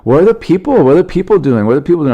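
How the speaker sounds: put-on high little-kid voice, insistent repeats; imitated curious daughter. Daughter asking about the laughing audience, imitated high child voice. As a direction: pester like a small child. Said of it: "and she goes".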